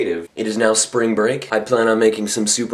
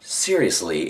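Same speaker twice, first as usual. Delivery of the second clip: normal talking voice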